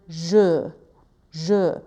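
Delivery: "je" with a rounded schwa, lips pushed forward; French schwa. Shown as a demonstration. Teacher demonstrating the French schwa in "je". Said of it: "For example".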